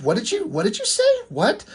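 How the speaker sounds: sudden leap way up in pitch, shocked; stunned disbelief. Retold or imitated. Eve to silent Jitsu, shocked high-pitched disbelief. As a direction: Eve can't believe what she just heard.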